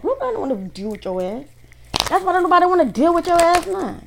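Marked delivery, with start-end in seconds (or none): 2.1-4.0 high, loud, angry scolding; pissed off. Real, not acted. Reader scolding the jealous ex, loud and furious. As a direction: hurl it at them, pitch way up. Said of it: "I'm pissed off all of a sudden".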